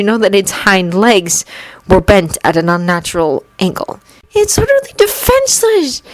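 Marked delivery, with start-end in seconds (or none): 4.3-6.0 put-on high boyish voice, hushed in disbelief; a lightly hushed young boy; boy's whisper. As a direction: Anakin horrified at the hurt creature, hushed. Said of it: "he whispered".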